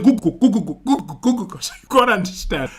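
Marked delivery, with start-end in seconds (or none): 0.0-1.6 rapid-fire imitated plane thudding; vocal sound effects. Passengers describing the landing to family, vocal sound effects. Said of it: "sound effects is just part".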